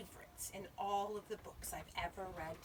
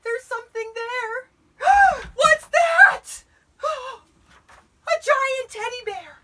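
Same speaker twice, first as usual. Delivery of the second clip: high, loud, gasping alarm; frightened astonishment; 23 dB louder, slower than usual. A narrator's reading. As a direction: gasp and cry out at a shocking sight. Eddie sees something huge, gasping.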